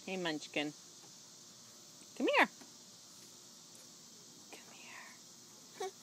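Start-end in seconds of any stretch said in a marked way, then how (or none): 2.2-2.5 high sing-song call. Real, not acted. Parent calling baby over, high sing-song. 4.5-5.9 breathy whispered call. Real, not acted. Parent whispering to baby to come over, hushed whisper.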